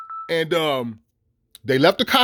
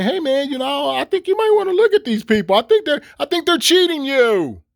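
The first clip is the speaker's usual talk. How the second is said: high-pitched, faux-innocent informant; mock-friendly tip-off; 7 semitones higher than usual. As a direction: act out a nice little letter, pitched way up. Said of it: "with a nice little letter".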